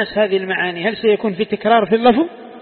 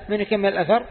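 ordinary talk

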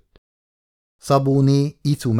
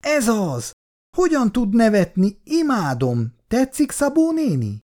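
high, gushing delight; thrilled, can't contain her joy; happy exclamation; 13 semitones higher than usual. A narrator's reading. Jutka gushes to Szabó néni about the laughing song, high and delighted. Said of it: "Jutka boldogan felkiáltott".

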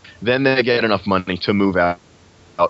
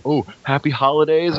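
higher-pitched, cautious holiday greeting; careful mock greeting; 8 semitones higher than usual. Mocking the worry over saying happy holidays, careful mock greeting. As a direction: imitate someone politely hedging a greeting.

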